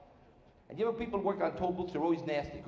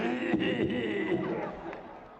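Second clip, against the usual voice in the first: drawn-out mock wheeze, falling away; high gasp-laugh, imitating nonsmokers among smokers; imitating everybody else; 6 semitones higher than usual. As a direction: act out a long, descending wheeze. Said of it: "everybody else is going".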